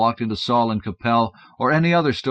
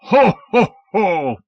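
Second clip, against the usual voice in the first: loud, higher Santa laugh; booming Santa; 10 semitones higher, 8 dB louder, much slower than usual. Acted. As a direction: roll in with a big ho ho ho. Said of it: "boomed Santa".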